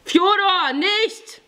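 very high, urgent shout; high-pitched panicked call; alarmed cry. A narrator's reading. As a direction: cry out to stop him. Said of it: "rief der Jüngere".

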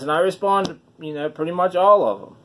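ordinary delivery

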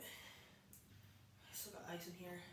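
slow breathless whisper. Real, not acted. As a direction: barely voice it, frozen. Freezing in ice bath.

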